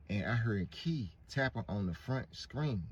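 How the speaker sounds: usual speaking voice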